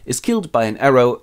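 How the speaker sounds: usual speaking voice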